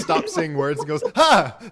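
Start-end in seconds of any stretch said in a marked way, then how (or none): 1.2-1.6 short high playful yelp; mock bee scream. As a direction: yelp out like a startled bug. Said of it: "It just starts screaming".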